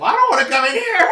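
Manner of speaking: high loud mock Mom; Mom refusing. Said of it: "slammed the door and said".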